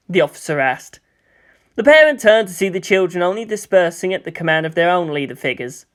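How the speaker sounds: usual speaking voice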